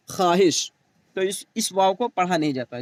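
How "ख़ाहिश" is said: "ख्वाहिश" pronounced khaahish, without the w; teacher's read form of "ख्वाहिश": khaahish; silent waw. Shown as a demonstration. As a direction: drop the w from "ख्वाहिश". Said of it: "लेकिन क्या पढ़ा जाता है?".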